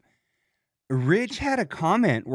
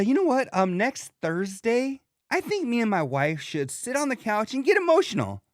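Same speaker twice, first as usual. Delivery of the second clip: higher-pitched pretend proposal; mock suggestion; 7 semitones higher than usual. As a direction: make a hammed-up date plan, pitched up. Mocks scheduling an emotional evening.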